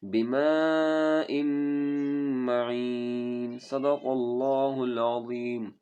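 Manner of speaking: slow chanted recitation with long held vowels; Quran recitation. Shown as a demonstration. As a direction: recite with a long madd on the final word. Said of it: "इस तरह".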